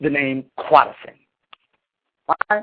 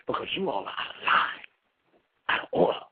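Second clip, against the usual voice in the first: whispered; 2 semitones lower, 9 dB quieter than usual. Acted. Preacher drops to scolding whisper.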